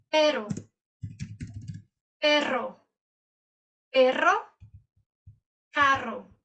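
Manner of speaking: "carro" and "perro" with a long trill, "pero" with a single tap; single vs double R. Shown as a demonstration. Teacher contrasting R words for students. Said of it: "palavra com dois R's".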